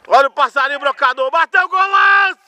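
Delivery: fast build into a shouted golaço; rising goal commentary. Real, not acted. Goal being scored live.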